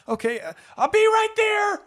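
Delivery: very high, loud call to the bar. Acted. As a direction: shout toward the customer. Calling out to customer.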